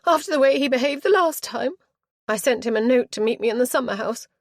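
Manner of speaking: put-on high, loud aggrieved girl; petulant young girl. A narrator's reading. Spoilt girl justifying her flight to the aunt, aggrieved. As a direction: whine it out indignantly.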